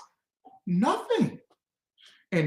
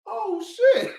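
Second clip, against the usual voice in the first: much higher, startled gasp of words; pretend shock; 10 semitones higher than usual. Imagined crowd reacting to the president, pretend shock. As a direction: blurt it like you can't believe it. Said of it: "Everybody then should have said".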